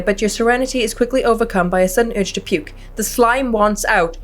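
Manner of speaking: ordinary delivery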